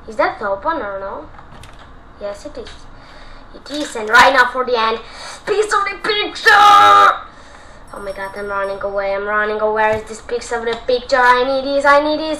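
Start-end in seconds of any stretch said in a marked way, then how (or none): 5.5-7.3 drawn out, high, loud cheer; triumphant shout. Real, not acted. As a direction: crow over the prize. Triumphant grab of item.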